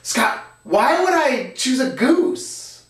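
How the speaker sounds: high, slow, exasperated kid imitation; totally exasperated. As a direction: plead like a child who can't believe the question. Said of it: "totally exasperated and was like".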